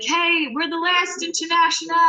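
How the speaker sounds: high-pitched mock yell to a crowd; retold stage yell. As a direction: call out like greeting an audience, without real volume. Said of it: "I yelled to the crowd".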